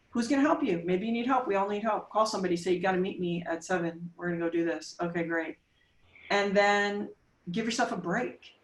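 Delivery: usual speaking voice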